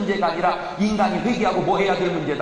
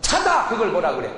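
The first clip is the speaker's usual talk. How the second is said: normal talking voice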